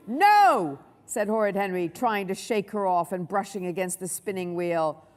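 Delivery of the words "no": loud, higher-pitched no; emphatic refusal. A narrator's reading. Henry refusing Lily's proposal, loud and emphatic. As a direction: burst out with a flat refusal.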